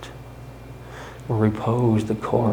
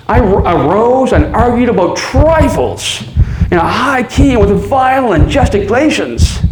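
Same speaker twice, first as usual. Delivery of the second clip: louder, driven, near a rant; rising frenzy; 2 semitones higher, 6 dB louder than usual. Acted. Narrator raving at the officers over the heartbeat, frantic. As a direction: push harder with each line into fury.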